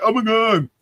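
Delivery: hammed-up distress, pitched high; mock outcry. Streamer mimicking people who switched their complaint, mock outcry. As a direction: imitate people wailing in protest. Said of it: "then they're like".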